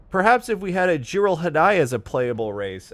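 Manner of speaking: loud, high, pompous know-it-all; pompous nerd. Retold or imitated. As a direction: announce it like a proud lore nerd. Nerd pipes up with lore name.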